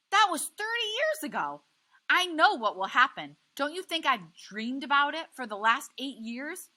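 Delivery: wife pleading, tearful and shouting; anguished tearful yell. A narrator's reading. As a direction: let the fear break out. Wife's tearful outburst.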